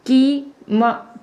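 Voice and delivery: कीमत stretched out very slowly, syllable by syllable; slow demo word. Shown as a demonstration. Teacher sounding out कीमत to students, very slow. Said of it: "और देखो का है".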